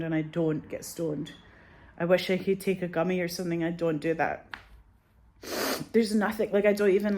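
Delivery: normal talking voice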